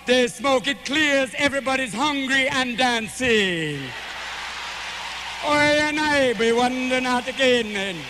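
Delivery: slow, laid-back Jamaican storytelling; Jamaican accent. Acted. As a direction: stretch the words out in a Jamaican lilt.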